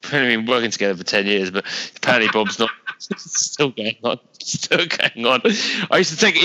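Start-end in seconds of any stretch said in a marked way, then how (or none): none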